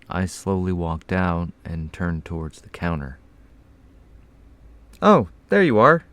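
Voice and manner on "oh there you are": loud, high-pitched customer exclamation; raised, high voice of a customer; exclaiming. A narrator's reading. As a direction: exclaim on spotting the missing clerk.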